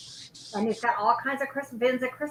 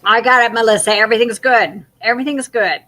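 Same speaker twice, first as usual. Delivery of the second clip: loud call across the room; 12 dB louder, faster than usual. Real, not acted. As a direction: call out to someone in the next room. Calling out to Melissa.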